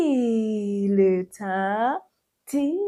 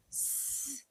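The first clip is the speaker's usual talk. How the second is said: ordinary talk, nothing marked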